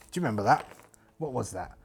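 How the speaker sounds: normal talking voice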